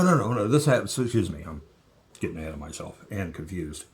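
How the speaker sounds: usual speaking voice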